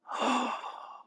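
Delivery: sharp, breathy intake of wonder; re-enacted gasp. Student looking up at the mosque ceiling, an awed gasp. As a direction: gasp as if suddenly seeing it. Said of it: "and I gasped".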